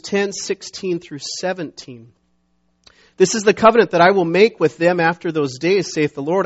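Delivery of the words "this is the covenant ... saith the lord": loud, declaimed Bible verse; emphatic pulpit reading; scripture reading. Acted. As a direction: proclaim it firmly to the congregation.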